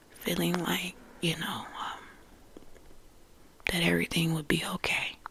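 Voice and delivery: whispered confession. Real, not acted. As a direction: keep it hushed and close. Confiding whisper throughout.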